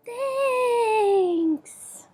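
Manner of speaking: all the friends together, gratefully; chorus of critters. Acted. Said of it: "And they all say".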